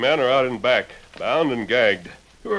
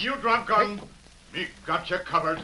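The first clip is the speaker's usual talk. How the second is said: Tonto's broken English; 8 semitones higher, 6 dB quieter than usual. Acted. Tonto covers them at window.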